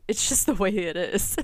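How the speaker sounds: laughing through "It's just the way it is". Real, not acted. Owning her tipsy habit to listeners, laughing. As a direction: shrug it off with a real laugh.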